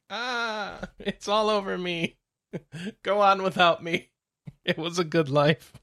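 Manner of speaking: hammed-up dying cat with laughs; overacted death scene. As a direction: melodramatic last words, laughing through. Cat dramatically dying under tape.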